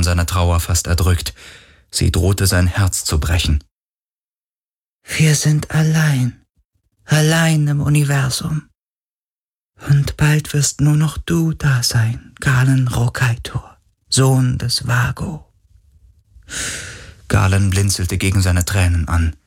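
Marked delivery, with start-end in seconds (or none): 5.0-15.5 tormented echoing moans. Acted. Ghost voice, echoing lament.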